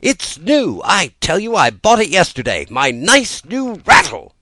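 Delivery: high, loud, building to a scream; rising scream. A narrator's reading. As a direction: Tweedledum screaming about his new rattle. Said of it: "his voice rose to a perfect scream".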